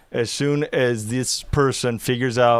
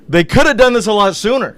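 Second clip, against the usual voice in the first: loud, high-pitched protest; worked-up, raised voice; heated reaction; 7 semitones higher, 7 dB louder than usual. Real, not acted. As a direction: exclaim it with commentator outrage.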